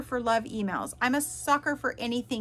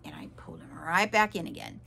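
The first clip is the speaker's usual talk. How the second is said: quick, low, almost whispered admission; rueful aside; 5 semitones lower, faster than usual. Real, not acted. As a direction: drop low and hurry through it. Rueful aside admitting she relented.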